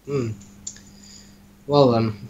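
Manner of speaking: ordinary talk, nothing marked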